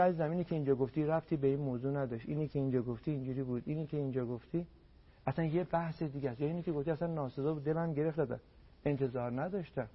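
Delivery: soft, quick, polite complaint; gentle respectful objection. Shown as a demonstration. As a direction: calmly tell him it hurt, softly. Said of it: "خیلی محترمانه".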